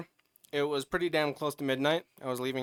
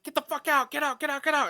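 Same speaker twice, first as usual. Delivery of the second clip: yelling at cat; 12 semitones higher, faster than usual. Retold or imitated.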